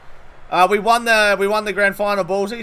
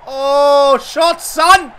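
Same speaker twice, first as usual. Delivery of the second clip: excited shout; 6 semitones higher, 7 dB louder, much slower than usual. Real, not acted. Cheering a big hit in game.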